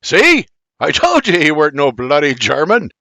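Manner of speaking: loud, high gloating Irish outburst; shouted I told you, in an Irish accent; blurting. Acted. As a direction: crow it out, triumphant.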